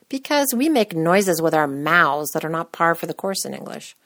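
ordinary delivery

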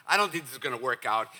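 fast, run-together phrase; rapid recital. Shown as a demonstration. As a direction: rattle it off as part of a list. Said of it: "let me just say these all real fast".

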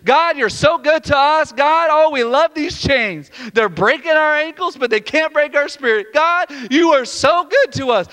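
loud, high fervent praise; acted jubilant prisoners' shout of worship; crying out praise. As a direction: cry it out exultantly to God. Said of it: "they just begin to cry out and praise God".

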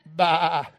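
drawn-out imitation of a sheep's bleat; mock sheep noise. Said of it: "that sheep do".

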